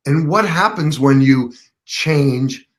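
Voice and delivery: acted-out prompting with drawn-out key words; leading question. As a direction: spell it out slowly so it dawns on them. Retold coaching, leading to the punchline.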